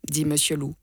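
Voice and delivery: plain reading voice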